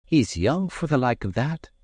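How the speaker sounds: lightly voiced young lass; a hint of a Scots maid. A narrator's reading. Maid's voice in story.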